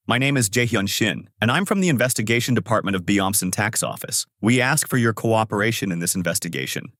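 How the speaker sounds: plain reading voice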